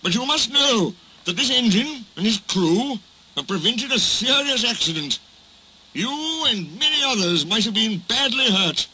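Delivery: high, weighty, emphatic address; stern lecture. A narrator's reading. Fat Controller setting the barber straight, emphatically. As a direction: lecture them with pompous authority.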